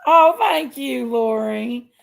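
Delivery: ordinary talk, nothing marked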